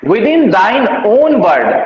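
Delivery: very slow, weight on within; slow verse recital. Shown as a demonstration. Teacher reciting the next sonnet line to students, very slowly. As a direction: let each word of the line hang.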